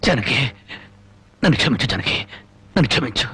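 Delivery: low, broken apology to his wife; begging forgiveness, voice sunk low; remorseful plea. Acted. Husband begs wife's forgiveness. (పశ్చాత్తాపంతో తక్కువ స్వరంలో వేడుకోలు)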